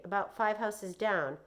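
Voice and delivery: plain reading voice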